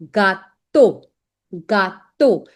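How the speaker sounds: "gato" said ga-TOH, ending in a long O; French pronunciation demo. Shown as a demonstration. Teacher models French word for students, clear ga-toh pronunciation. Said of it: "E A U gives the sound of O".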